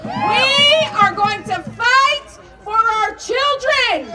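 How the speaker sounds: rally speech. Acted. Repeated rally refrain.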